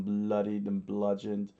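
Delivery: usual speaking voice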